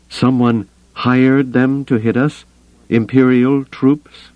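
high, slow stunned question; incredulous. A narrator's reading. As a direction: draw it out in high disbelief. Stunned character questions the news.

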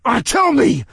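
high, loud scream. A narrator's reading. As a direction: scream the demand. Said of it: "I screamed".

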